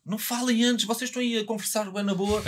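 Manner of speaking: high-pitched imitation of a nagging organiser; mock scolding. Battle organiser nagging the rappers not to chat beforehand, mock scolding. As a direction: tell them off in a high, acted-out voice. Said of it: "estava sempre a dar-nos na cabeça".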